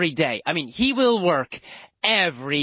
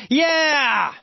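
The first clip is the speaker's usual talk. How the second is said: cheer; 7 semitones higher than usual. Real, not acted. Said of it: "you are finished with the lessons".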